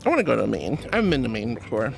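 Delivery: normal talking voice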